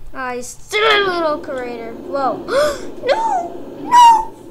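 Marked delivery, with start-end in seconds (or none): 2.5-3.0 sudden startled gasp; sharp high gasp. Real, not acted. Scare in the game. 3.1-4.4 very high panicked cry; scared squeal. Real, not acted. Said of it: "I'm so scared".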